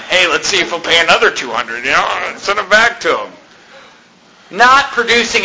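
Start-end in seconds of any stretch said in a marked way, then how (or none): 0.1-3.3 lightly voiced smug clerk, a touch of mischief; mock office clerk. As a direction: play the bored official enjoying the scheme. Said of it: "thinks it's fun".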